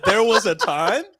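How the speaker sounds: high, puzzled reading. Retold or imitated. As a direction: read "There was a time" as if confused by it. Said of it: "I looked at that and said".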